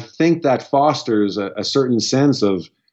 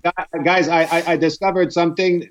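higher-pitched teasing imitation of a friend's accent; George Saint Pierre's accent; 5 semitones higher than usual. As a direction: put on his accent, pitched up, stammering into the announcement. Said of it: "that was good accent".

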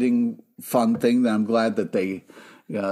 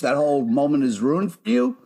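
ordinary delivery

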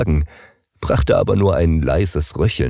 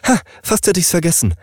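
sudden recollection; 11 semitones higher, faster than usual. Acted. Nurse suddenly remembers.

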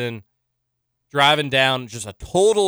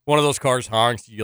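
ordinary talk, nothing marked

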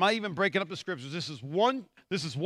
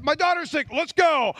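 higher, urgent retold plea; acted impatience; 8 semitones higher, 5 dB louder than usual. Father hurrying Jesus to his sick daughter, acted impatience. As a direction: hurry Jesus along, hammed-up.

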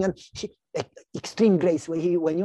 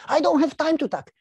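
high, louder, urgent objection; impatient protest; 10 semitones higher, 5 dB louder than usual. Acted. Desperate believer answers an invitation to sit, impatient. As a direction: protest in a hurry, pitched well up.

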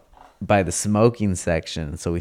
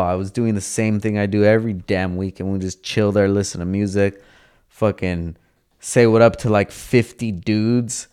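ordinary talk, nothing marked

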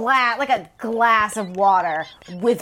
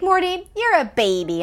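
much higher teasing coo; mock baby talk; 10 semitones higher than usual. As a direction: tease him like a toddler. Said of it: "And she's like".

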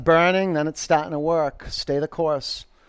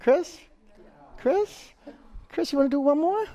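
high coaxing call; 12 semitones higher than usual. Real, not acted. Coaxing Chris into another round.

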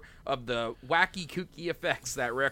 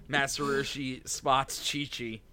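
usual speaking voice

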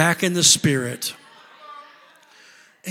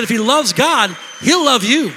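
repeated louder, hammering it home; emphatic repeat; 5 semitones higher, 8 dB louder than usual. Real, not acted. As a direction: drive it home the second time. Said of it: "I said".

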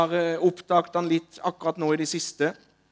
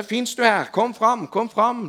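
raised, higher calling to the hall; 6 semitones higher, 4 dB louder than usual. Retold or imitated. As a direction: call out to the crowd, louder. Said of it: "og han ropte". (ropende, høyere stemme)

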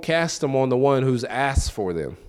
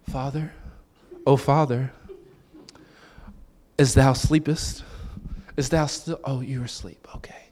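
lightly voiced child at the bedside; mock prayerful child; 5 dB quieter than usual. Boy waking his sleeping father, soft mock solemnity. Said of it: "when the boys can't sleep".

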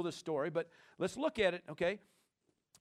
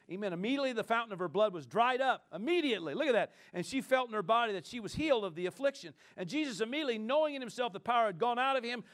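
ordinary delivery

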